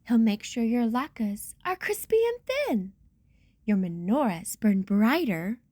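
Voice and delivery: ordinary delivery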